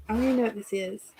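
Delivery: ordinary delivery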